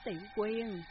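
loud, panicked call of a name; rising panic, shouting her name; frantic calling out. A narrator's reading. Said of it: "vừa hớt hải kêu lên".

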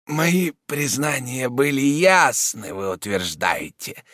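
slow, laboured words of a sick man; struggling to speak. A narrator's reading. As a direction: drag the words out slowly with effort. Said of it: "с трудом выговаривая слова".

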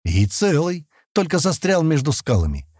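mild satisfaction; pleased. A narrator's reading. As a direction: report it with approval. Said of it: "довольным тоном проговорил он".

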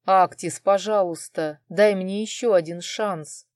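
ordinary delivery

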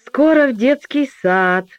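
put-on thorough, serious child explaining; high, careful child voice; earnest little girl. A narrator's reading. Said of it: "обстоятельно стала рассказывать".

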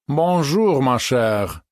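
languid drawl of a bored dandy; breathy vintage purr, weary and affected; томный голос. A narrator's reading. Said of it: "томным голосом уставшей от военных действий персоны протянул кузен". (томно, манерно тянуть слова)

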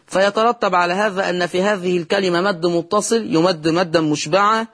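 ordinary talk

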